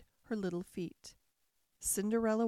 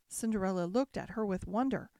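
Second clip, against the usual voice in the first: ordinary delivery, nothing marked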